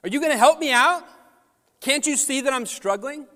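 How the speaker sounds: high, loud, fast frustrated pleading; a fed-up child begging dad for help; exasperated kid. Retold or imitated. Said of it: "That's a".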